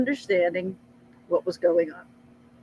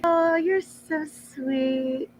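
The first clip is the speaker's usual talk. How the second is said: sweet mock praise; 7 semitones higher, much slower than usual. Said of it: "everyone's always like".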